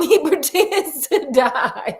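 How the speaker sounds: giggling through the words; laughing. Real, not acted. Amused at the faked death, laughing. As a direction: laugh while retelling it.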